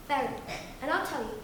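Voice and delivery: plain reading voice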